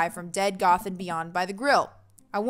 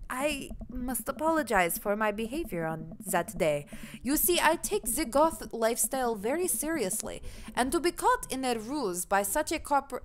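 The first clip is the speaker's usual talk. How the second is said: put-on German accent drifting into Russian; badly faked German accent turning Russian; formal apology in a wobbly German-Russian accent; German slipping Russian; 3 dB quieter than usual. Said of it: "nah, see, it just sounds Russian".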